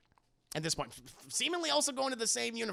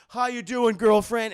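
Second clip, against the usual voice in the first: loud, higher, showy hello; brash boyfriend greeting; 4 semitones higher, 11 dB louder, slower than usual. Retold or imitated. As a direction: swagger in with a booming greeting.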